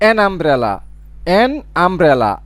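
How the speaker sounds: an umbrella with the u said as আ, an run into it; sounding out umbrella. Shown as a demonstration. Teacher to class, showing an umbrella's আ sound. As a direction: say an umbrella as one linked word with an আ sound. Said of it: "ইউর উচ্চারণ হচ্ছে আর মতো".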